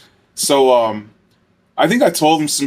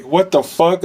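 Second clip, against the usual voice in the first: amused disbelief; 6 semitones higher, slower than usual. Retold or imitated. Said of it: "I was like".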